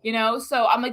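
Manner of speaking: ordinary delivery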